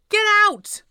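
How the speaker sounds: high, loud furious scream. A narrator's reading. Upset daughter throwing mum out of her room, screaming. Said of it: "I scream".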